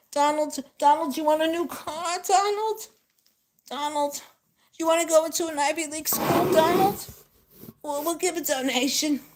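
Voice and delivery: high, sing-out pampering parent voice; mock fussing mommy voice; doting parent. Voicing Donald's pampering parents.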